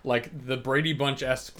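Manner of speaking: usual speaking voice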